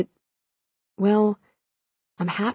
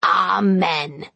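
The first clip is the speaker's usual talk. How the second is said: witchy mutter. Acted. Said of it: "muttered a witchy voice".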